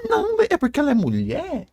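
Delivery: high-pitched mimicry of a complainer; mocking objection. Throwing the fans' sexism excuse back at them, high mocking voice. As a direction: parrot it in a high mock voice.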